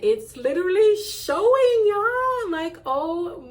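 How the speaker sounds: thrilled rise in voice; excited. Real, not acted. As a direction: lift up with joy. Excited about her impact.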